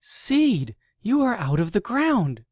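high, delighted cry of surprise; excited greeting. Acted. Grub, thrilled to see Seed sprouting, cries out happily. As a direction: call out thrilled to see a friend.

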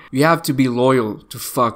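ordinary talk

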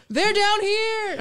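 high, drawn-out alarm call; mock yell. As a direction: call out slowly to alert the guards. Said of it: "he yells even louder".

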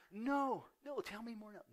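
put-on high, loud protest of a child; insistent granddaughter.

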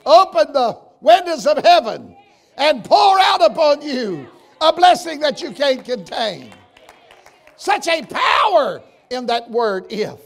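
shouting preacher's climax; preaching climax shout. Acted. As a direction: build to a fiery peak.

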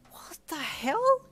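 slow, breathy, rising high; gasped disbelief. A narrator's reading. Player reaching for the ice ball, gasping. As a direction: gasp it out in shock. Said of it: "you gasped".

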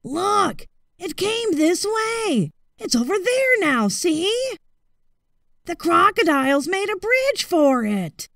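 boar screaming. A narrator's reading. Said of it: "he heard a wild boar scream".

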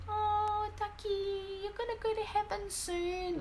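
put-on high, sweet child's voice for the cat; imitating her children. Mother voicing her kids talking to the dying cat, high and sweet. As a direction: play a little kid talking to the family cat. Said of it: "and my children going".

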